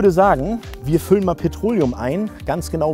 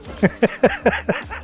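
bubbling run of higher-pitched laughter; amused laugh; 7 semitones higher than usual. Real, not acted. Host amused that pumping is like milking, laughing. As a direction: laugh in quick pulses, pitched up.

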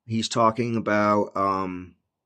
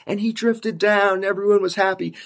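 mock storybook sweetness; 8 semitones higher than usual. Parodying the Lord drifting down, high mock-sweet voice. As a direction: float it high as a mock happy ending. Mocks a gentle version of coming.

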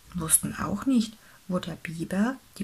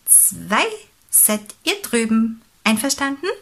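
lightly voiced, emphatic pelican; animated storybook bird, a touch louder; pelican taking charge; 4 semitones higher, 5 dB louder than usual. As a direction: lay out the plan briskly, counting with gusto.

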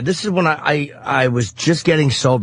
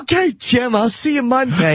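raised, self-satisfied sendoff; smug fat boss; 8 semitones higher than usual. Retold or imitated. Supervisor sends Jim off before the hooker, smug raised voice. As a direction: play the pleased old supervisor. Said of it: "he was like".

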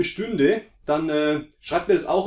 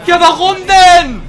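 loud, slow, high exasperated cry; exasperated shout; 12 semitones higher, 12 dB louder, slower than usual. Real, not acted. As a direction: wail it in disbelief. Frustrated at game.

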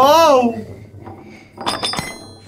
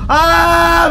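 startled shout; 9 semitones higher than usual. Real, not acted. Shocked by more monsters.

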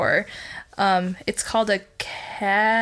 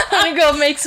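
laughing; 6 semitones higher, 5 dB louder, much faster than usual. Real, not acted. Joking through laughter.

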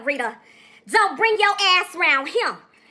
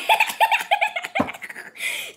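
high-pitched cackle; high laugh burst. Real, not acted. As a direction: let out a squealing laugh. Laugh alone.

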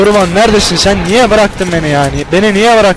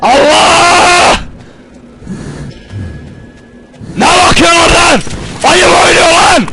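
terrified shouting; 10 semitones higher, slower than usual. Real, not acted. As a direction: shriek at the screen in real fright. Terrified gamer shouting during attack.

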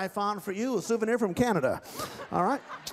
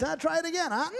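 ordinary talk

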